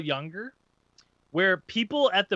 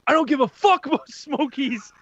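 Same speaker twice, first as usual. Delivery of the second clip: imitating a heated guy, raised pitch; mock angry outburst, higher; 8 semitones higher than usual. Angry stranger snapping at the man offering Smokies, heated. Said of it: "getting heated".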